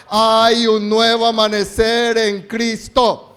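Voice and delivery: loud, slow preaching call; congregation call-and-response. Real, not acted. Pastor leading the congregation in a loud slow refrain. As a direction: lead the church in a shouted declaration. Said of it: "Vamos, iglesia".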